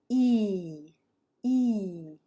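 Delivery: "E" stretched out in a falling arc; long vowel. Shown as a demonstration. Teacher demonstrating long vowel sounds, drawn-out "E". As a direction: hold "E" long, letting the pitch glide down. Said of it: "they're gonna be longer and there's kind of an arc".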